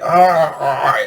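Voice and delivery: drawn-out groan of mock pain; exaggerated cringe "oh"; cringing groan. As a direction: groan as if hearing something awful. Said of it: "it totally makes me cringe".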